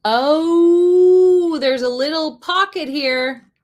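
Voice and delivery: long, loud, high "oh"; real loud, high oh of discovery; sudden realization. Real, not acted. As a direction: draw out the oh as it dawns on you. Sudden realization about the pocket.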